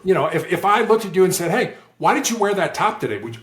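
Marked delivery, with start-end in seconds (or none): 1.5-3.2 higher-pitched, challenging question; accusatory why question. Shown as a demonstration. Negotiator demonstrating a why question, accusatory. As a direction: put them on the spot with the why. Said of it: "why questions sound for us accusatory".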